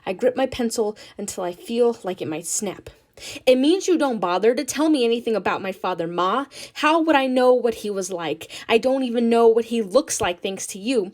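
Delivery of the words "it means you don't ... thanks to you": loud, fast, bitter accusation; angry outburst. Acted. Nina accusing Ma about her father, loud and bitter. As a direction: let the resentment burst out.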